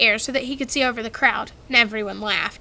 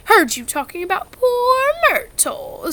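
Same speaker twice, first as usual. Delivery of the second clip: higher, teasing poltergeist voice; sly Peeves; 9 semitones higher than usual. A narrator's reading. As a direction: needle them slyly. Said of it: "said Peeves".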